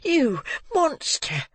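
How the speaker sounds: high, slow, appalled accusation; horrified cry. A narrator's reading. Boy accusing the murderer to his face, high and horrified. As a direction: fling it at him in horror. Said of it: "looking with horror in his eyes".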